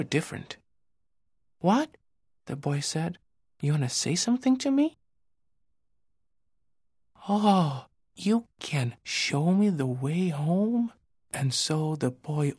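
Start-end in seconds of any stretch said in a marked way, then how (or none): 1.6-2.0 put-on young boy voice. Boy speaks to firefly. 3.6-10.9 put-on young boy voice. Boy speaks to firefly.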